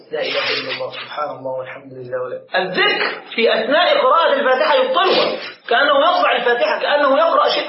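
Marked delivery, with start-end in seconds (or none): 0.1-2.6 low, soft murmured litany; interjected dhikr. Shown as a demonstration. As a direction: drop far down in pitch and volume, as if praying privately. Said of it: "فإن تخلل الذكر بين موالاتها". (ذكر بصوت منخفض خافت)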